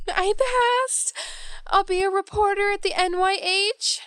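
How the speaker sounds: high, elated announcement; thrilled, voice leaping up high; jubilant. Acted. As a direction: burst out with the great news. Tells parents of new job.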